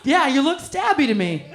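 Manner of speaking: usual speaking voice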